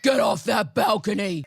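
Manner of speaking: loud gruff shout. Pretend. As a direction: bark it like a tough guy. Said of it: "your inner world voice".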